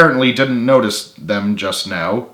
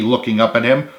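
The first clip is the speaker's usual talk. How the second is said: ordinary delivery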